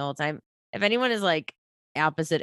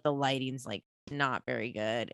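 ordinary talk

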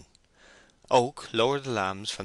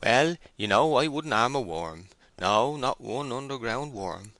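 higher-pitched, nervous self-defence; very uneasy; 5 semitones higher, 4 dB louder than usual. A narrator's reading. Matthew Moon defending himself to the shepherd, uneasy. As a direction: protest your harmlessness uneasily, slowing at the end. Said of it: "looking very uneasy".

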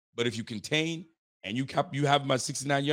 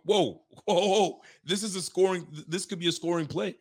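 mock surprised announcer; 6 semitones higher than usual. Imitating surprised announcer call.

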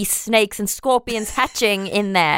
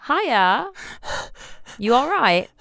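high, gentle stranger's greeting; injured woman voice; 5 semitones higher than usual. Acted.

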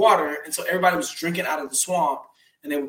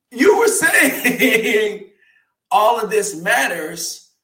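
laughing through the words, louder and slow; can't hold back a laugh mid-sentence; 5 semitones higher, 9 dB louder, slower than usual. Real, not acted. Laughing at critics' hypocrisy.

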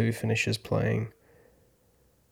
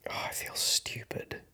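whispered, barely voiced; whispered self-reproach; 10 dB quieter than usual. Real, not acted. Scolding himself after bad news, whispering. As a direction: breathe the words out in a whisper.

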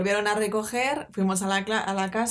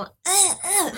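straining grunts; 11 semitones higher than usual. Retold or imitated. Being hoisted through window.

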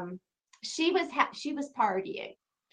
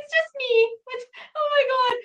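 flustered laugh; 5 dB louder than usual. Retold or imitated. Retelling calming an excited fan.

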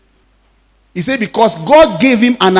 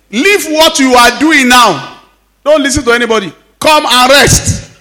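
firm order; 6 semitones higher, 5 dB louder than usual. Retold or imitated. Pastor ordering son to rest.

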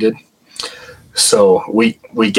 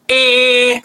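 high sharp electronic "eh" beep; imitated device beep. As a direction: mimic the meter's alarm tone, much higher. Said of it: "it makes this loud ... sound".

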